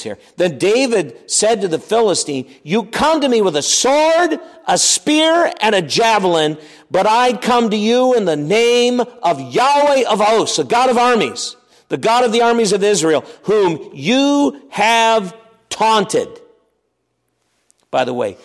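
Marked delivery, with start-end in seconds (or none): 2.6-6.6 proclaimed. Shown as a demonstration. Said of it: "it'll give you chills". 6.9-16.4 preaching proclamation. Acted. Preacher proclaiming David's challenge.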